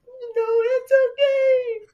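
fake-cry it bravely; mock crying whine. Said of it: "and I was like".

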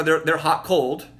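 normal talking voice

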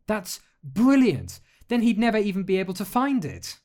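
high, loud, thrilled outburst; excited girl. Acted. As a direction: burst out with delight. Alex's eyes brightened, excited idea.

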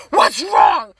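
loud, startled demand; alarmed shout. Retold or imitated. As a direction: act out someone jolted awake by screaming. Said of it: "when I wake up screaming".

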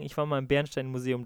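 usual speaking voice

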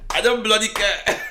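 high, loud mock outburst; retold defiant shout. Bullied boy answers his schoolmates, defiant shout. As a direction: act out a fed-up child shouting back.

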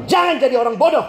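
fast, half-laughing shout; shouted rebuke to the junior; laughing scold. Retold or imitated.

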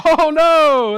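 high, loud hammed-up panic; mock terror. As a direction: squeal it like you've seen a ghost. Disciples terrified at Jesus appearing.

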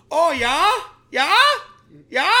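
put-on loud, high German-accented questioning; exaggerated German, each word jumping upward; mock German accent. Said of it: "it's just weird to be like".

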